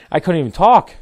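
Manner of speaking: tearful. Real, not acted. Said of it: "I'm in tears".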